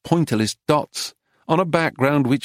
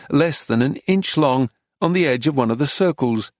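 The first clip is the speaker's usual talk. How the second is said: ordinary delivery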